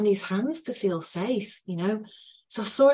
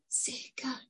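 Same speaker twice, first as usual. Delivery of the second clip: a hushed, higher, gentle sicher; soft soothing; 6 semitones higher, 14 dB quieter than usual. Retold or imitated. Teller soothing the boy, hushed and gentle. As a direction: whisper it softly to calm him.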